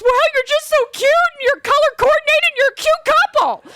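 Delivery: very high, cutesy chirp; gushing photographer. Retold or imitated. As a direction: gush in a squeaky-high voice as the photographer. Gushing photographer explains her mistake.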